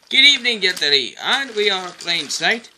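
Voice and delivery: calling out. A narrator's reading. Parading engines greet the others, calling out. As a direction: hail them as you pass.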